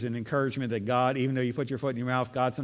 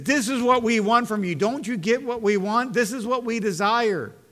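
higher and louder insistent argument; protesting Peter; 6 semitones higher, 4 dB louder than usual. Acted. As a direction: press Jesus to take over as king.

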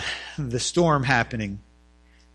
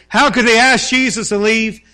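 indignant outrage; 9 semitones higher, 10 dB louder than usual. Acted. Mocking our own indignation.

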